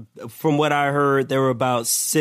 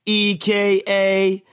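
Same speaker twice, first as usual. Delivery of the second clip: raised, declaimed punchline delivery; announcer-style roll call; 7 semitones higher than usual. Acted. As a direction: proclaim the punning alias like a ring announcer. Performs punning nickname roll call.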